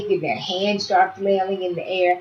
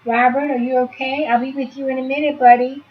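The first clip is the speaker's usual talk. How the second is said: reassuring; 6 semitones higher, 4 dB louder than usual. Shown as a demonstration. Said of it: "if that baby hears your voice".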